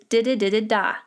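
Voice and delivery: "da-da-da-da-da" as one word, "di-di-di-di-DAH"; four quick light "da" syllables, landing on a strong last "da"; stress on the dah. Shown as a demonstration. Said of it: "Always put your emphasis on das".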